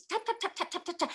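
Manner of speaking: soft, high tapping; light mimicked clack of keys; typing taps. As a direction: tap out the typewriter keys. Imitating typewriter keys.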